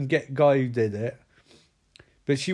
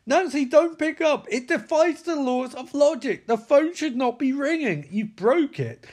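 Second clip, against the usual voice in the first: mocking Nancy; 9 semitones higher than usual. Narrator mocks Nancy as the phone rings, high and whiny. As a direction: squeak out a mock-panicked Nancy.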